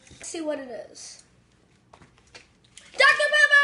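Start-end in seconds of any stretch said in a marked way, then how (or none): 1.9-3.6 loud, high, drawn-out cheer; excited shout. Real, not acted. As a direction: shout it out in triumph. Guess revealed correct.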